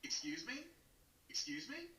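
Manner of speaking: mock a fussy customer flagging the bartender, pitch rising every time; mocking impression. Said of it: "your impression of how Max says".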